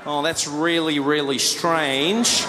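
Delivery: mock Australian puzzlement, drawn out slowly for everyone to hear; slow, loaded thinking aloud; pointed passive-aggressive. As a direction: stretch each phrase to make someone uncomfortable. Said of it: "try and speak loud and make you uncomfortable".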